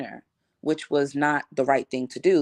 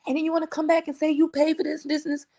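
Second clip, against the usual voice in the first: mocking friend; 14 semitones higher than usual. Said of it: "And she was like".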